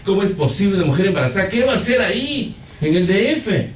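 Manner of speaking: high, mock-desperate complaint; comic outraged wail; retold tearful protest to God. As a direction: act out pleading with God, pitch climbing. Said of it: "llorando, y diciendo al Señor, pataleando".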